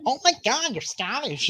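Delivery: high, swooping tourist squeal, imitated; mock gushing excitement. Americans finding out he is Scottish, mock-thrilled gushing.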